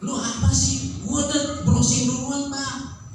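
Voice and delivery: put-on know-it-all teenager talking back, high-pitched; cocky youngster. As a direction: brush off the old-timer.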